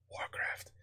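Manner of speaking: breathy whisper of one word; a sly whispered jab; whispered aside. Pretend. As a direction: slip the name in as a secret.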